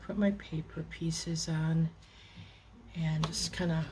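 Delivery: usual speaking voice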